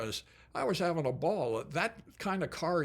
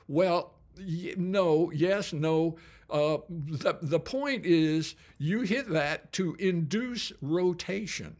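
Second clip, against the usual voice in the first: louder Southern coach, flustered, then slow; a Southern instructor correcting a newbie; Southern accent, stumbling then firm; 3 semitones higher, 4 dB louder, slower than usual. Retold or imitated. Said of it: "when I imitate accents".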